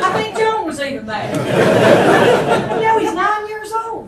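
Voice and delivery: high, loud laughing disbelief; indignant laughter. Real, not acted. Mother telling the audience about her son being cut, laughing indignation. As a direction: laugh at the outrage of it.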